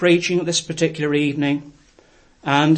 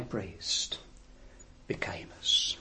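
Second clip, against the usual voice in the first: drops to a much lower, softer whisper; 8 semitones lower, 16 dB quieter, slower than usual. Acted. Preacher ends the text in an awed whisper. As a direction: hush it in awe.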